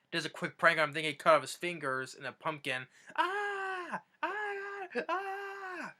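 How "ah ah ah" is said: a string of high, panicked screams. Retold or imitated. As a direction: shriek like you think your fingers just got cut off. Grandson thinks his fingers are cut.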